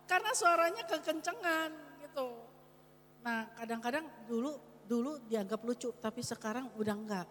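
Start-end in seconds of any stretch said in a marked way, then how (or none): none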